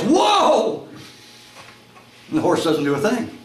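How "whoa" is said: high, panicked yell. Acted. Rider heading right for a cliff, high yell. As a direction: yell to halt a runaway horse. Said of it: "and he yells".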